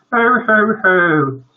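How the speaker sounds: high, loud mock sobs; boo-hoo like an overcome mother; wife bursting into tears. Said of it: "turn to burst into tears".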